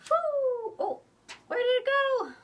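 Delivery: high, loud playful squeal; excited whoo. Acted. Librarian launching a paper bat, squealing playfully. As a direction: cheer as the bat flies off.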